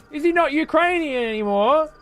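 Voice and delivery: high, mock-whiny voice imitating a friend; mocking Joe. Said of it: "fucking Joe's in here going".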